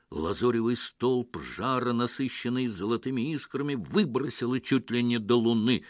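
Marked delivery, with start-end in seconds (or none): none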